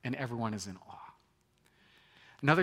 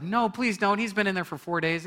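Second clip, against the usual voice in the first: high, loud, urgent plea; alarmed protest; 8 semitones higher, 6 dB louder than usual. Acted. Martha begging Jesus not to open the tomb, alarmed.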